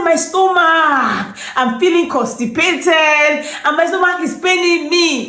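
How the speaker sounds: high, slow drawn-out moan; moaning sick friend. Retold or imitated. Friend describing constipation on the phone in a slow moan. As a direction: groan it like your belly aches. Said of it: "She said".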